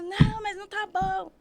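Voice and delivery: high, insistent mock midwife; lightly voiced scolding midwife; a hint of a pushy nurse; bossy midwife. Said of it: "Ela falou".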